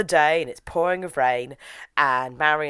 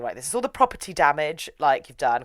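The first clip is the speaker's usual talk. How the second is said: ordinary talk, nothing marked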